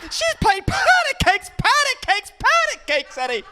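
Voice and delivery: very high, loud, drawn-out screech; mock film villain. Comic acting out bathtime as Roger Rabbit's villain, manic high shriek. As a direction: screech it like a crazed cartoon villain.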